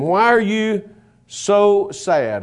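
slow searching question. Shown as a demonstration. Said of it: "And so Jesus asked them".

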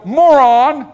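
loud, high angry name-calling; acted anger. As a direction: blurt the insult in hammed-up anger. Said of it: "am I angry now, I go".

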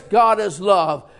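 usual speaking voice

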